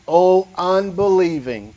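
"O unbelieving" drawn out slowly, each syllable weighed; slow, weighty "O unbelieving". Shown as a demonstration. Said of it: "that's an important word".

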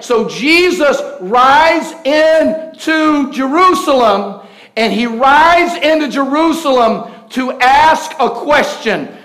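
loud, high, driving sermon voice; thundering pulpit delivery, swelling to shouts; full-force preaching. Acted. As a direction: preach it big to the whole church.

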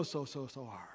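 soft and hushed, as God comforting the hurt; tender, quiet. Acted. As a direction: ease off into a near hush of sympathy. God consoling the hurting.

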